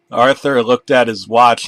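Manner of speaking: ordinary delivery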